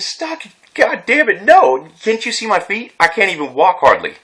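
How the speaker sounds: high, incredulous outburst at the police; exasperated retort. Retold or imitated. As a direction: protest it in disbelief, voice jumping up. Retold angry protest to police.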